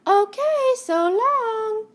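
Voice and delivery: tumbleweed saying goodbye, cheerfully. Acted. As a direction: wave her off brightly. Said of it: "said Rosie cheerfully".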